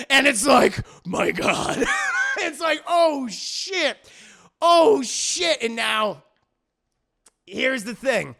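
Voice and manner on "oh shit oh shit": loud shocked gasp of words. Retold or imitated. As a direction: react in loud disbelief. Shocked reaction.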